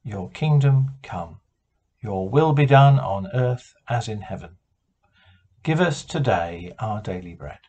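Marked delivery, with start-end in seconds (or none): none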